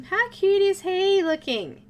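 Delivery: high, loud, drawn-out cooing; gushing. Real, not acted. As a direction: gush over something adorable, stretching it out. Gushing over the cute coloured figure.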